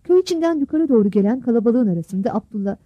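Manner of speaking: ordinary talk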